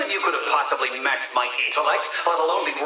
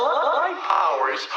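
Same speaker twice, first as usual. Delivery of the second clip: mocking; 7 semitones higher, slower than usual. Riddler mocks a hero's powers.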